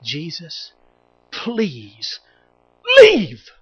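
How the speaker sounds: desperate plea. Acted. Peter begging Jesus to leave him, hushed then bursting out. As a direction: beg him to go, ashamed. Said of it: "Peter said it this way".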